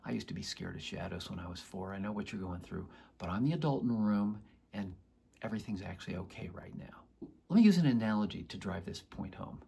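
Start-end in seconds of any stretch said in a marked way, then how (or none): 0.0-7.3 softer, gentle comforting near-whisper; calm a frightened four-year-old softly; soothing a scared child. Retold or imitated. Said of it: "You talk to a scared child by going towards the child".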